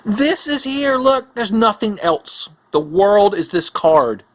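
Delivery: mock scolding. Real, not acted. Scolding camera, higher, slow.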